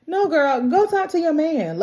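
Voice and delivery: put-on high-pitched sassy girlfriend advice; exasperated urging. Urging a character, playfully.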